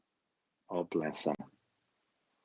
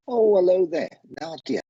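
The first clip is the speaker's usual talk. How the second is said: excited greeting; 11 semitones higher, 5 dB louder than usual. Real, not acted. Greeting a new caller.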